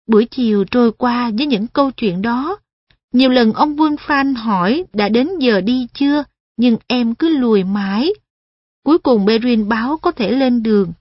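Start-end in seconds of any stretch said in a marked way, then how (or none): none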